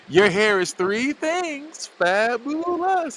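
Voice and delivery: high, drawn-out waiter's compliment; acted waiter. Waiter gushing over a customer's hair, high and drawn out. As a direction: stretch each word out high in a mock waiter voice. Acting the waiter's line to them.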